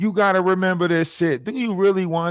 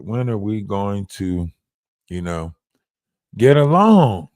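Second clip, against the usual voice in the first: mock plea; 7 semitones lower, 3 dB louder than usual. Pleading plea to French.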